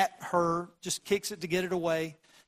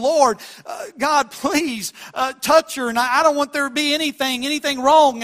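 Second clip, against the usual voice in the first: loud, high pleading cry to God; urgent yelled prayer on the way to hospital; shouted prayer; 7 semitones higher, 10 dB louder than usual. Retold or imitated. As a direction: call out to God in panic. Retold urgent prayer, shout heard.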